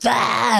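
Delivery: high imitated kid's scream; mock tantrum yell. Parent imitating kids who are so wrong, high tantrum yell. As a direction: yell out like a kid mid-fit.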